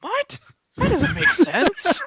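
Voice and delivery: high, loud, drawn-out incredulous what; overplayed shock at nonsense; mock outraged disbelief. Mock outrage at odd reading.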